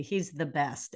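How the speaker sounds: usual speaking voice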